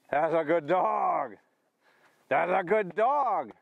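slow, high, stretched praise; lavish praise on the dog slowly; drawn-out praise. Real, not acted. Trainer praising his dog's retrieve, slow and high.

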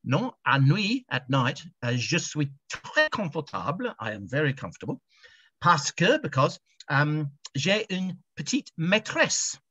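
a halting English schoolboy attempting French. To the Frenchman, a touch of English-accented schoolboy French. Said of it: "in my best schoolboy French".

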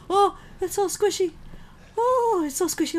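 high-pitched, squirming squeal; high voice of disgusted fascination; squeamish squeal. Real, not acted. Squirming at squishy noises.

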